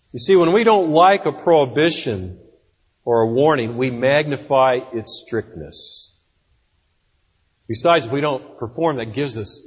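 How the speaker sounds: ordinary delivery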